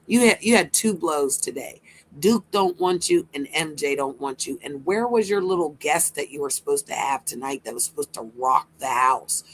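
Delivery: ordinary delivery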